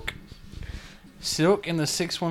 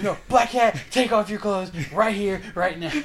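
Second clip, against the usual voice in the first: loud, high hammed-up order; playful mock command, a laugh at the end; mock demand; 6 semitones higher, 4 dB louder than usual. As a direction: bark it like a cartoon hero. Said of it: "He goes".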